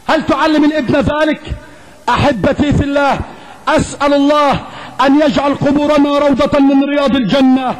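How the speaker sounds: ordinary delivery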